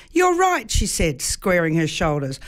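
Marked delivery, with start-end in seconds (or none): 0.1-0.7 high, firm woman's voice; resolved woman. A narrator's reading. The mother agreeing with the gentleman, high and determined.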